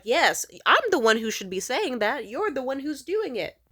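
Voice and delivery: loud, indignant comeback; exasperated retort. Retold or imitated. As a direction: throw it back at him, loud and rising.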